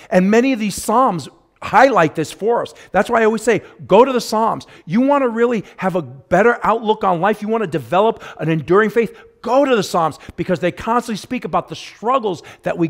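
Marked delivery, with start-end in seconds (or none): 0.1-10.2 louder, higher, pressing exhortation; fervent preaching. Acted. Pastor urging the flock to read the Psalms, fervent preaching. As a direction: urge them like a pastor on fire.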